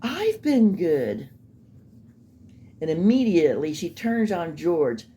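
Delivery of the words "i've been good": higher, slow, earnest imitation of her pleading; mock her wide-eyed plea, hand on heart; a prim, wounded little plea; hand on chest, mock sincere. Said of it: "she clasps her hand to her chest and says".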